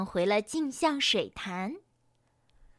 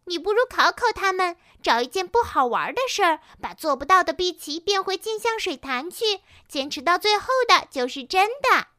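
high, raised voice urging an idea; loud reminder; 6 semitones higher, 4 dB louder, faster than usual. A narrator's reading. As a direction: call out the suggestion, pitched up. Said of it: "他站起来大声地提醒子越说". (提高嗓门大声提醒)

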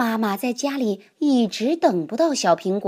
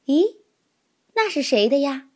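put-on higher, louder surprised child's question; 6 semitones higher, 4 dB louder than usual. A narrator's reading. Children spotting an unknown heart on the tree, surprised. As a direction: gasp it out curious and amazed. Kids spot a new heart.

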